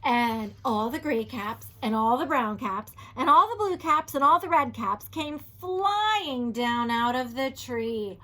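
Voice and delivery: usual speaking voice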